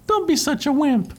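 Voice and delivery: high dismissive cartoon Mario; Mario's voice. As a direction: brush him off up high. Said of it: "and he goes".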